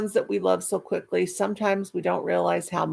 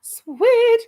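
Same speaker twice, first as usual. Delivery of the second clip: squealed high and loud; a delighted high loud squeal at good news; 9 dB louder than usual. Real, not acted.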